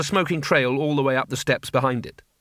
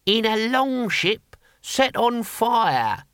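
put-on slow, high old warrior voice; dim old barbarian; 9 semitones higher, much slower than usual. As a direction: drawl it out simply. Boy Willie's voice.

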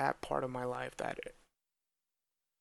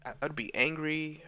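usual speaking voice

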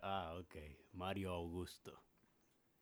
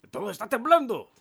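high, loud mock panic; panicked shout; 18 dB louder, faster than usual. Mario warning housemate of tremor, panicked yell. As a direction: yell in hammed-up alarm.

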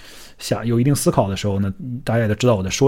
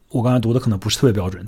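ordinary delivery

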